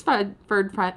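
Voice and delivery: ordinary delivery, nothing marked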